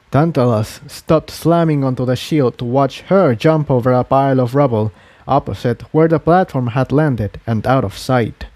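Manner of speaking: usual speaking voice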